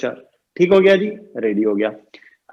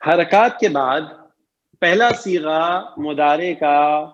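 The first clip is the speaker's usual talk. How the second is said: normal talking voice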